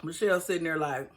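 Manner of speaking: usual speaking voice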